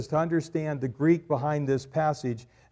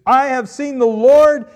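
much higher, loud cry of joy; joyful proclamation; 10 semitones higher, 14 dB louder than usual. Acted. Mary telling the disciples, joyful loud proclamation. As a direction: announce it with joy. Mary's announcement quoted.

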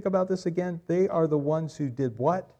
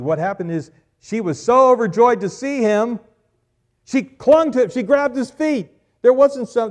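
much higher, loud excited preaching; preaching at full force; 9 semitones higher, 10 dB louder than usual. Acted. Preacher to congregation, how Mary clung to Jesus, loud joy. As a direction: burst out with her joy.

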